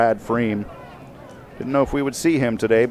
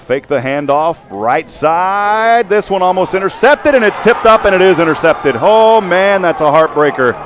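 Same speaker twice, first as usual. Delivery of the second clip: excited call rising higher and louder; live play-by-play; 5 semitones higher, 4 dB louder than usual. Real, not acted. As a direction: build to a high, loud cry on the interception.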